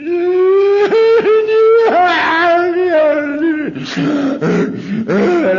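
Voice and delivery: a long, high, loud sob that bursts out; breaking into sobs. Retold or imitated. Recalling the call to AA, breaks into loud sobbing. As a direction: let the crying break through, high and loud. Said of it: "I just bawled and bawled".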